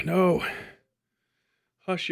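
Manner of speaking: normal talking voice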